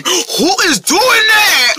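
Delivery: high, slow, stretched-out yell; exasperated shout. Acted. Friend irritated by a mystery noise, exasperated shout.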